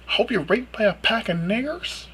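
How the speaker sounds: incredulous. Retold or imitated. Quoting Mel in disbelief.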